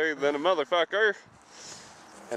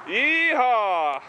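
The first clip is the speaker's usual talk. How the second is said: loud cowboy whoop; yeehaw; 6 semitones higher, 4 dB louder than usual. Real, not acted. Riding off-road, whooping.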